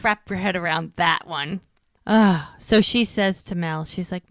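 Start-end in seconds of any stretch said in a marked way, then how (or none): 2.1-2.4 loud "ugh" groan; short, loud exasperated ugh. Real, not acted. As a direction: groan it out in disbelief. Disgusted reaction to imagined scene.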